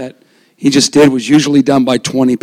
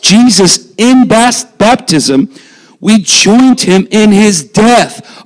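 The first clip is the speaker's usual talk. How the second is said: raised, fervent preaching voice; 7 semitones higher, slower than usual. Acted. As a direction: preach it with rising fervor. Preaching reading Romans.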